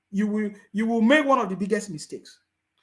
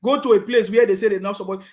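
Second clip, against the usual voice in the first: usual speaking voice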